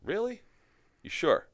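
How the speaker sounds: high, unbelieving "Really?"; doubtful surprise. Retold or imitated. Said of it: "And I'm like".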